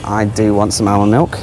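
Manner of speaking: usual speaking voice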